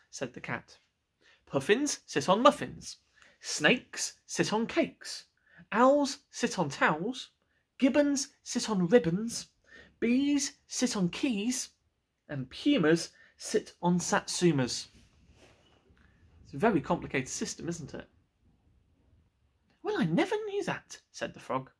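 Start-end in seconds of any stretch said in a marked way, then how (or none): 1.5-14.9 slow, deliberate reciting of rules; know-it-all cat. Acted. 19.8-21.0 high, wide-eyed surprise; amazed frog. Acted.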